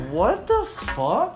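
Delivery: drawn-out, high-pitched retold shock; retold disbelief. Man retelling being woken by a flying cup, stunned. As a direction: stretch it out in mock disbelief. Waking to a cup smashing overhead.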